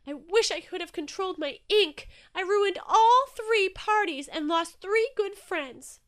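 high, loud wail of regret; distraught, stretching out the words; tearful cry. A narrator's reading. Inky alone after ruining three parties, tearful cry. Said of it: "cried Inky, tears stinging his eyes".

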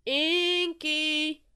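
high, long, loud holler. A narrator's reading. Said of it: "he heard a familiar voice call".

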